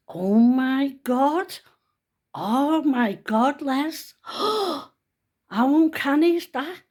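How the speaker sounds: high, slow, loud gasping amazement; retold shock. Telling Les about the whisper, high amazed gasp. Retelling her shocked reaction.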